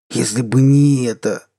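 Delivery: put-on slow, wailing drunk lament; drunk self-pity. Drunk self-pitying rant.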